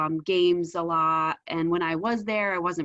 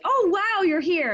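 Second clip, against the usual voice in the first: high, bright "oh wow" she didn't get; mock delighted surprise, pitched way up; mock happy greeting; 10 semitones higher, 5 dB louder than usual. Imagining a son happily greeting his mother, mock delight. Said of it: "it wasn't like".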